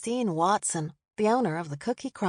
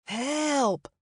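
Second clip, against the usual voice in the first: ordinary delivery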